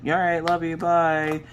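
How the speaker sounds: sleepy brush-off. Retold or imitated. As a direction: toss off a drowsy, dismissive goodbye. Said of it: "And I'm just like".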